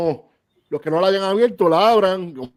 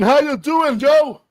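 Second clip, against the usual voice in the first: lucha libre announcer; 12 semitones higher, 7 dB louder, much slower than usual. Acted. Host welcomes third guest.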